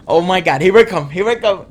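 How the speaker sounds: playfully panicked self-talk; retold panic. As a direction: blurt it out in comic dread. Retold dread before register turn.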